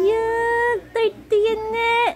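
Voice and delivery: loud, very high, drawn-out complaint; high squealed disgust. Real, not acted. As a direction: squeal it out, stretching each word. Scolds child over something dirty. (patiling pandidiri)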